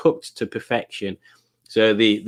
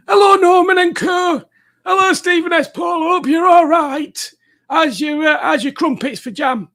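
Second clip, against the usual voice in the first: much higher, louder squeal of a voice; silly squeaky voice; 5 dB louder than usual. Pretend. Reading Stephen's chat greeting in a much higher silly voice. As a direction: read the message as a cartoon squeaker.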